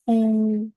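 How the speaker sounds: "pou" high, long and level; sustained, unbending high "pou"; first tone. Shown as a demonstration. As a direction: hold "pou" on one high note. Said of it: "le ton constant, le premier ton".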